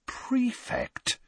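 breathless, stunned whisper; incredulous whisper. A narrator's reading. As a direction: barely voice the word in disbelief. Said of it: "staring incredulously at the letter".

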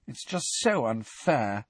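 slow, numb complaint; numbed, softened sense of injustice. A narrator's reading. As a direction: say it slowly, drained of fight. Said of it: "said Alicia numbly".

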